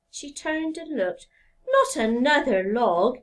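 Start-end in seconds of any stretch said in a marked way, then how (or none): 1.7-3.2 weary sigh, louder; a tired let-down sigh; sighing wife. Acted. Wife sighing at yet another log, weary. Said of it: "she sighed".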